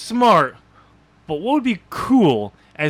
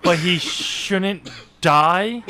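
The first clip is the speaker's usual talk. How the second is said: baffled slow reread; 3 semitones higher, much slower than usual. Real, not acted. Host baffled by the plot.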